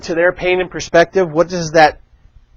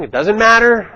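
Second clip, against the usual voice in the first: louder, higher, pressing the point on matter; emphatic; 6 semitones higher, 4 dB louder than usual. Shown as a demonstration. Coaching listeners not to argue, emphatic insistence. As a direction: insist it doesn't matter, raising the voice. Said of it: "repeats phrase for emphasis".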